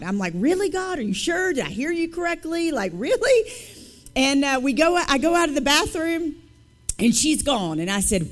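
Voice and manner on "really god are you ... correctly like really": high, doubting questions; battling God. Retold or imitated. Woman questioning God whether she heard correctly, incredulous. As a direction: question it in pitched-up disbelief. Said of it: "I'm battling God".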